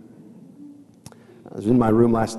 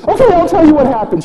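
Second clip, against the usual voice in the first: put-on high, loud, panicked child voice; hammed-up squealed rush; Chloe; 10 dB louder, faster than usual. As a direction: imitate a little girl blurting it out fast. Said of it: "she says".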